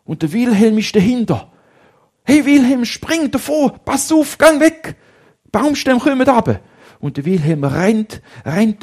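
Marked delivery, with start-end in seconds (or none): 2.2-6.6 much higher and louder, yelling to run; boy yelling at his friend to jump clear; urgent warning shout. Acted. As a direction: scream a warning to get away. Warning friend of rolling logs.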